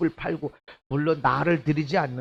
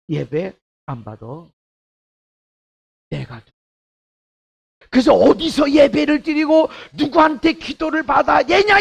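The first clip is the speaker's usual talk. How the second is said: ordinary talk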